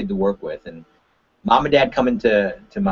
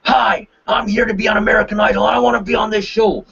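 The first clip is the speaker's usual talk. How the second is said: much higher, pushy audition; overbearing audition hello; too aggressive; 12 semitones higher than usual. Shown as a demonstration. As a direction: barge in demanding a spot. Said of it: "one very, uh, too, aggressive".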